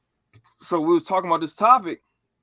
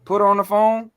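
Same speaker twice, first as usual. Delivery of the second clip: loud, raised-pitch order; imitated angry demand; 3 semitones higher, 6 dB louder than usual. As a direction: act out an uncle demanding the phone. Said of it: "went ham on her".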